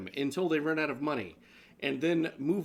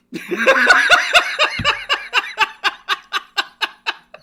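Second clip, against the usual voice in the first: high, loud burst of laughter that will not stop; loud helpless laughter; 14 dB louder than usual. Real, not acted. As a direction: laugh hard at your own joke. Big laugh after joke.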